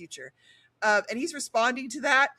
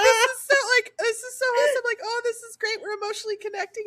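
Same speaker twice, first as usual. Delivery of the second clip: higher, fast, bubbly gushing; mock gushing; 8 semitones higher, faster than usual. Listener retells swooning at the emotional scene, higher and bubbly. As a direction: gush like you're swooning. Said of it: "There was something like".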